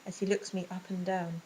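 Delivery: ordinary delivery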